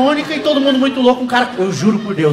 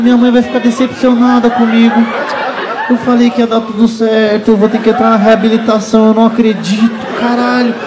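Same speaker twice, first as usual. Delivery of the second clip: mock panic; 2 semitones higher, 4 dB louder than usual. Panicked inner monologue about drugs.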